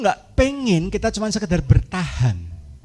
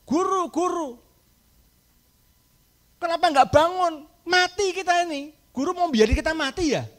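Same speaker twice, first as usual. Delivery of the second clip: panicked disciples; 10 semitones higher than usual. Acted. Disciples panicking in sinking boat.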